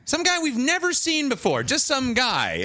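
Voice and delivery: high, exasperated some guy; mocking disbelief. Real, not acted. Viewer mocking the anticlimactic reveal in high exasperated disbelief.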